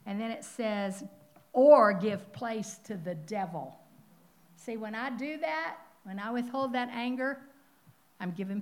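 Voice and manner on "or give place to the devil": forceful scripture reading. Acted.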